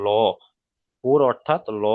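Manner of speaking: "ল" full and heavy, like "lo"; rounded-mouth L. Shown as a demonstration. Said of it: "মুখটা গোল হয়ে যাবে". ("ল" মুখ গোল করে, পুর)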